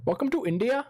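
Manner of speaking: high-pitched mock Indian accent. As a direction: imitate a comedian's Indian accent. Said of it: "His Indian accent's so good".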